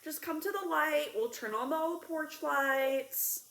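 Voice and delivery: a hint of a reassuring grandma; grandma's reassurance. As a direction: soothe like an old grandma. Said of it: "she is like".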